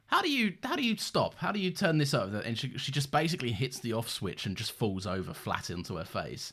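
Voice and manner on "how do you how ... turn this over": lightly acted, higher-pitched plea; higher, imploring retold line; panicked Tardis quote. Retelling the Tardis's funny line, lightly acted plea. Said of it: "the tardis goes like".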